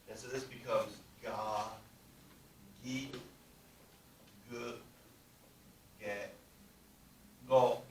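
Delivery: ga, gi, gu, ge, go sounded out; voiced g syllables. Shown as a demonstration. Teacher sounding out g row for students. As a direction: voice each ga, gi, gu, ge, go from the throat. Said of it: "put these little marks there".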